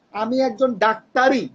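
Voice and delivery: slow, insistent ডাক্তারই; stress on ডাক্তারই. Shown as a demonstration. Doctor answering doubt with stress on ডাক্তারই. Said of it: "কিভাবে আমাকে response করবে".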